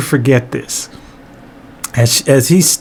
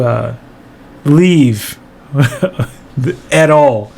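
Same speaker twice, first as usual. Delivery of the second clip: ordinary talk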